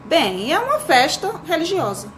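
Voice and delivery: lightly voiced father searching for an answer; pai respondendo. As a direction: explain to a child, slightly hesitant. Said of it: "o pai respondendo". (pai explicando ao filho, de leve)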